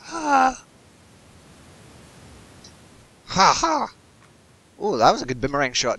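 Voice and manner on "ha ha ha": triumphant laugh. Real, not acted. Boomerang hits its target.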